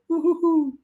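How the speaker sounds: a high sing-song woo-hoo-hoo; playful imitation of a cheer; imitating his woo-hoo. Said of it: "voice note of you going".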